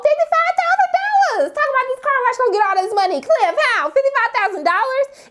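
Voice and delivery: high-pitched disbelief, rushing in the middle; incredulous outburst. Retold or imitated. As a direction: exclaim in mock outrage at the sum. Mock outrage at Cliff's debt.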